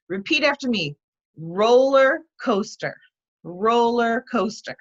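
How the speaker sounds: slow "roller coaster" with stress on "ro" and "coast"; pronunciation drill. Shown as a demonstration. Teacher drilling "roller coaster" for students to repeat, slow, stressing first syllables. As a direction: model "roller coaster" slowly, leaning on the first syllable of each word. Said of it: "stress on the first syllable".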